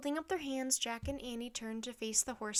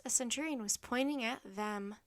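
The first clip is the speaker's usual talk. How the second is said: ordinary delivery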